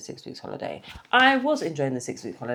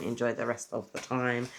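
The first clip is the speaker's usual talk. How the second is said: usual speaking voice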